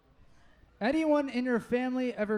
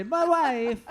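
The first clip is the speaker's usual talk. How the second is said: loud, higher catchphrase impression; Borat; 5 semitones higher, 5 dB louder than usual. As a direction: blurt it as a comic accented catchphrase. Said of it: "anyone wanna do a Borat impression".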